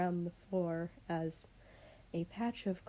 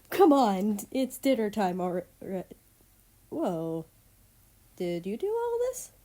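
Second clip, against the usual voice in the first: excited call; 7 dB louder than usual. Acted. Girl bursts in calling out.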